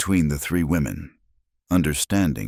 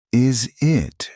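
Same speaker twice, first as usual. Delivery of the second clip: mocking; 9 semitones higher than usual. A narrator's reading. Miriam's mocking reply.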